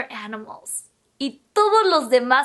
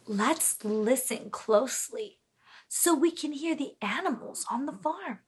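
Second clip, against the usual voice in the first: much softer, quick hush; 4 semitones lower, 10 dB quieter than usual. Shown as a demonstration. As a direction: drop the voice to a hush. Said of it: "hushed listening cue".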